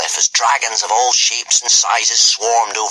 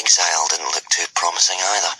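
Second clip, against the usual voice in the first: ordinary delivery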